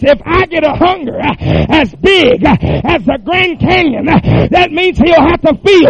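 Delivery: shouted, high revival preaching at full force; whooping revival preacher voice; fiery preaching. Acted. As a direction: preach it hard to the congregation, loud and high.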